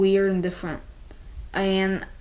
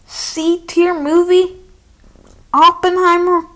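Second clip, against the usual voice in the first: much higher, louder disbelieving question; incredulous; 8 semitones higher, 8 dB louder than usual. Pretend. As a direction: echo the C tier movie in outraged disbelief. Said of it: "you may be thinking".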